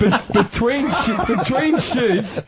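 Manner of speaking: can barely get it out for laughing. Real, not acted. Telling the podcast hosts a porn set story, laughing through it.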